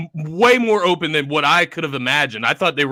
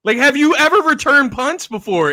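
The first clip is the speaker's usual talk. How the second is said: mocking, incredulous question; sarcastic; 8 semitones higher, 5 dB louder than usual. Real, not acted. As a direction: drip with sarcasm. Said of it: "like, sarcasm, obviously".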